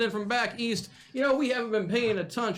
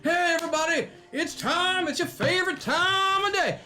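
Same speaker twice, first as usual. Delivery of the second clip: big booming ring announcer; hype announcer; 3 semitones higher than usual. Acted. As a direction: pump up the crowd, announcer style. Announcer hyping the crowd.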